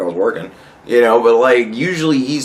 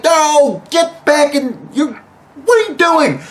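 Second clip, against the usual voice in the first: mock staffer; 13 semitones higher, slower than usual. Staff shooing Trump back inside.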